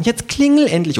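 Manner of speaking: high, urging creature voice; impatient kangaroo. A narrator's reading. Kangaroo waiting at party door, impatient and high.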